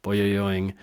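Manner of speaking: a bouncing boing sound effect; spring it out like a cartoon boing; springy boing. Shown as a demonstration. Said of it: "that's a masculine sound right there".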